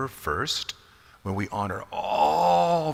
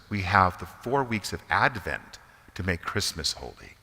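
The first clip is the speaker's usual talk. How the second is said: normal talking voice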